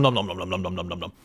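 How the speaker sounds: fast, soft, low nom-nom patter; nom nom chewing. Pretend. Streamer joking about his chewing speed, fast soft noms. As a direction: rattle off the noms like a hamster. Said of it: "That was not my normal chewing speed".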